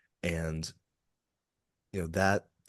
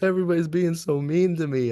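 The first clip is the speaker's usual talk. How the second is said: high, loud imitation of a tearful drunk; mock bawling; 11 semitones higher, 9 dB louder than usual. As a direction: wail it in imitation of someone bawling. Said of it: "he just starts bawling".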